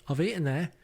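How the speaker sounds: half-hearted excited exclamation; mock shout. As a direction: pipe up as if boasting at the TV. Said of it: "No doubt I'll shout".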